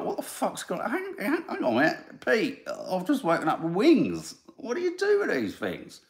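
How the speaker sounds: high bewildered squeak at waking with wings; dazed flying ant. Retold or imitated. Host imagining flying ants waking with wings, mock bewildered voice. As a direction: play a confused ant, voice jumping up in panic. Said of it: "Almost like they were going like".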